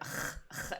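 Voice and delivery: voiceless guttural R sound demonstrated; raspy back-of-the-throat R drill; French throat R. Shown as a demonstration. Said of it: "R's are".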